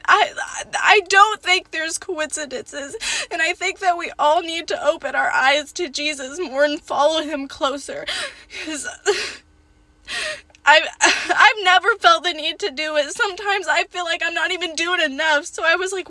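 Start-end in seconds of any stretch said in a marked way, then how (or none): 4.7-15.0 tearful words with a laugh breaking through; crying while talking. Real, not acted. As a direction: talk through real tears. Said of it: "Gosh, I'm, like, bawling".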